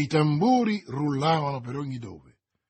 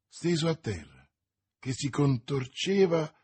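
ordinary delivery

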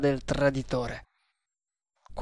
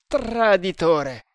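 high, louder and slowed "Traditore!"; indignant cry; 5 semitones higher, 5 dB louder, much slower than usual. A narrator's reading. Servant raging at the traitor, a slow loud cry. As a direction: spit out the insult with outrage. Servant curses the treacherous attacker.

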